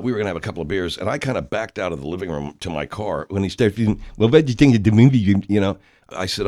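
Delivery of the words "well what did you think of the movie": loud, fast buck-toothed gabble; higher, hurried mock rabbit voice; rabbit impression. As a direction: mangle the words through a pretend overbite. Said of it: "that's how a rabbit would talk to you".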